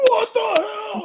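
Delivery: mock shocked shout; director's shocked yell. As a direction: yell it in sudden disbelief. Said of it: "He's like".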